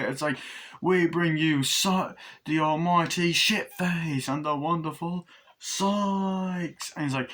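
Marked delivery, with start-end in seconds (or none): 0.8-6.9 slow, grand, higher-pitched showman; puppet show announcer. Retold or imitated. Presenter at a puppet show, grand drawn-out announcing. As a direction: stretch it out like a stage introduction. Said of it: "At a puppet show".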